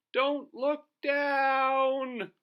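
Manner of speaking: very high, slow, loud drawn-out call; high squeaky worried shout; high cartoon warning. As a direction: stretch it out in a tiny squeaky voice. Towed high into the air.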